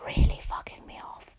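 annoyed whispered aside. Real, not acted. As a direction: hiss it under your breath.

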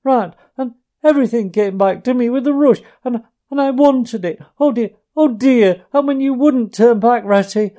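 high, loud, broken sobbing pleas; sobbing brokenly. A narrator's reading. Mole to Ratty, longing for his lost home, sobbing brokenly. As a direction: choke the words out between sobs. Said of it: "he sobbed forth at last brokenly".

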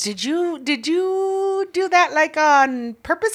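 high, drawn-out questioning; imitating a fussy feminine man, slow; feminine guy. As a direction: stretch the words in mock disbelief. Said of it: "it was a feminine guy".

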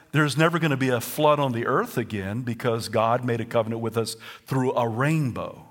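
normal talking voice

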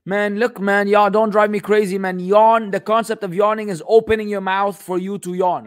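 worked-up, emphatic rebuke; exasperated. Real, not acted. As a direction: protest as if being driven crazy. Exasperated by the absurd question.

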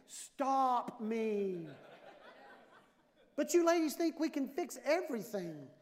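normal talking voice

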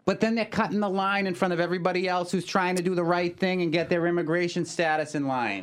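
lightly voiced objecting parent; a hint of an arguing parent, high; devil's advocate parent. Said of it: "devil's advocate with a Boston accent".

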